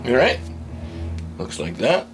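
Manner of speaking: normal talking voice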